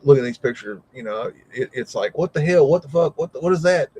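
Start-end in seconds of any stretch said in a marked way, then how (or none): 2.2-3.9 yelling at the screen. Retold or imitated. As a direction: shout your disbelief at the pictures. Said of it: "screaming at the screen".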